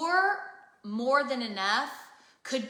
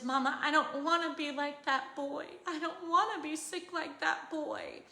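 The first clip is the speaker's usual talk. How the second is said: lightly imitated small daughter, slightly higher and soft; daughter's voice; 4 semitones higher, 5 dB quieter than usual. Mother retelling her scared daughter's words, in a lightly put-on child voice. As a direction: plead gently like a worried child. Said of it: "she said".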